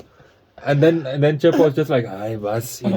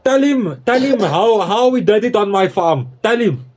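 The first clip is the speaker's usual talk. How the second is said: mock ancestor voice; 7 semitones higher than usual. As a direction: play a bossy colonial ancestor giving orders, lightly hammed. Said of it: "I'm his ancestor".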